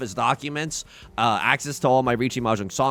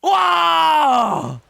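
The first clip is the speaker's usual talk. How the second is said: high, loud rising yell of disbelief; excited shout; 14 semitones higher, 6 dB louder, much slower than usual. Real, not acted. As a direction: let it build into a full real shout. Reacts to big game moment.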